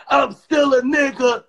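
loud, high, drawn-out declaration; defiant shout. Real, not acted. Man ranting to friend about the guard, defiant shout. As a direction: shout it out slowly with pride. Heated boast after casino story.

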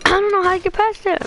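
frustrated at being stuck; high, exasperated complaint. Real, not acted. Stuck on a hard section.

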